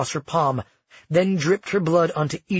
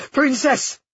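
much higher, loud call; shouted; 11 semitones higher, 4 dB louder than usual. A narrator's reading. Nick shouting at Lucia to get her attention, loud and high. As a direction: shout her title to stop her. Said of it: "Nick shouted at Lucia".

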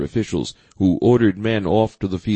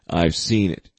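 ordinary delivery, nothing marked

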